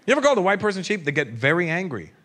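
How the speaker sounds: ordinary delivery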